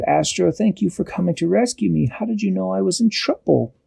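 high-pitched grateful thanks; small high voice thanking a rescuer; grateful little character. A narrator's reading. Dashi thanks Astro for rescue, high grateful voice.

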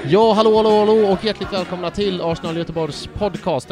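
loud, bright show opening; podcast host intro. Acted. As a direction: open the show with a big welcome. Programledarens intro. (pampig podd-intro)

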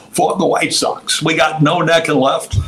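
high-pitched sportscaster impression; Harry Caray. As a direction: announce the lineup high and drawn out, imitating a broadcaster. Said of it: "Harry Caray comes on".

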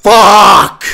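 loud furious curse; frustrated shout. Real, not acted. Vlogger cursing at the endless leaf blower noise, real frustrated shout. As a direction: let the anger burst out in one word.

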